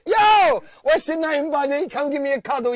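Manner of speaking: high, loud laughing yell; shrieking laugh into a shout. Real, not acted. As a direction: laugh it off loudly at the caller. Host mocks abusive prank caller.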